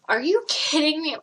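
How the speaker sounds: incredulous, as if retelling the last straw; exasperated. Retold or imitated. Worker reacting to the customer's mess on the table, exasperated.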